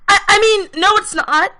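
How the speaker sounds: a caught-out friend scrambling to take it back; flustered stammer. Acted. Zhang hastily denying to Isabella, flustered high stammer.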